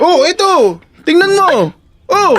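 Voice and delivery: high, worked-up challenge; defiant outburst. Acted. Boyfriend defiantly showing her his secret, worked up. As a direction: dare the partner to look.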